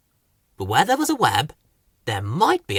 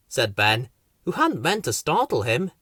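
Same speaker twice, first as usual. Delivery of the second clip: usual speaking voice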